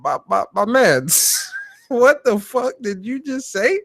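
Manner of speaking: stunned and cracking up, stretching it out; laughing disbelief. Retold or imitated. Streamer retells his own stunned reaction to the exec, slow and laughing. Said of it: "I said".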